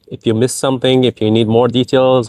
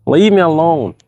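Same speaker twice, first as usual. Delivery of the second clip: shooing away; 8 semitones higher than usual. Real, not acted. Shooing someone off mid-meal.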